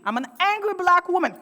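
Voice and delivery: loud, high, heated outburst. Real, not acted. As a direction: let the anger burst out. Ranting about being called angry.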